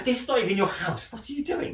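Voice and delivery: high, hammed-up disbelief at the characters; mock exasperated. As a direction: squeal it in mock outrage at the screen.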